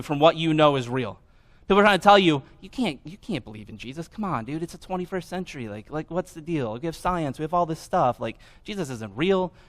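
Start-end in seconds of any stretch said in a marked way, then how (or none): 2.6-9.5 soft, casual talking-down; dismissive doubter. Retold or imitated. Sceptical relative discouraging a believer, soft and dismissive. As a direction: quietly talk a friend out of it.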